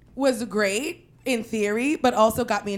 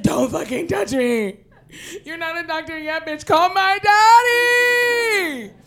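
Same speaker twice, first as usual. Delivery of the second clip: angry crying scream; 7 semitones higher, 7 dB louder, slower than usual. Retold or imitated. Injured, screaming at teammates.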